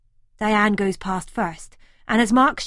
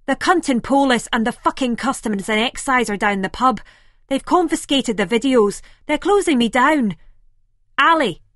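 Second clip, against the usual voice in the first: louder and higher, rising panic; panicked outburst; 4 semitones higher, 6 dB louder than usual. A narrator's reading. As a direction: blurt out the bad news in alarm. Simon panicking on the phone.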